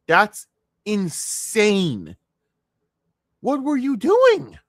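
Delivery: high, slow disbelieving yell; incredulous outburst. Real, not acted. Streamer yelling at the video in disbelief, incredulous high shout. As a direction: demand it like you can't believe anyone could be this dumb.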